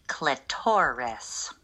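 slow, stiff dictionary pronunciation voice; mechanical voice. Pretend. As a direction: say Clitoris like a slow pronunciation key. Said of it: "I'll play the mechanical voice".

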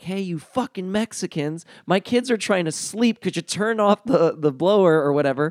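high, loud imitation of mom yelling; mock angry yell. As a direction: yell out the door at the workers. Mom yelling out door at landscapers.

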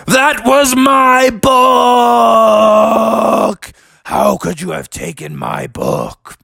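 theatrical monster outrage; scary voice. Acted. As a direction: bellow high and slow, then sink to a menacing growl. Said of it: "your voice is pretty scary".

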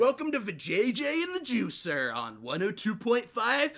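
loud, high hyped radio host. Pretend. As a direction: pump it up like morning radio. Playing morning radio hosts.

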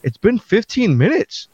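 incredulous outsider. Retold or imitated. Outsider's incredulous retort in cartoon.

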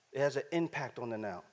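soft repetition. Real, not acted. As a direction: say it softly, almost to yourself. Preacher repeating his point softly.

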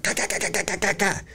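rapid, soft throaty "ka" noises imitating rocks; imitated rock clatter. Storyteller recalling rocks thrown on aluminum shades, imitated clatter. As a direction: mimic stones rattling off metal, high and light.

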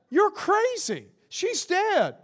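high-pitched, incredulous jeer; mock scoffing. Mourners to Jesus about the girl, high mock scoffing.

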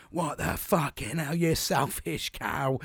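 retold outburst. Father snapping at his daughter over a chicken dipper, mock furious. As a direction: re-enact losing it, drawing each word out. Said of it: "Well, I went fucking Sparko".

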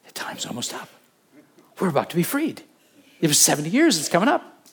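half-whispered realization; lightly voiced, as if to himself; murmured wonder at the prophecy; a hint of Daniel, hushed. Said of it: "and he says".

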